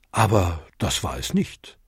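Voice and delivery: ordinary delivery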